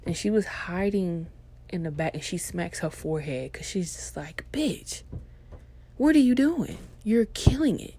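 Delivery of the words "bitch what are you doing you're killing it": high, loud mock scolding; exasperated boss. Hidden boss reacts to clerk's awkwardness, high exasperation. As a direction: imitate a boss who can't believe it.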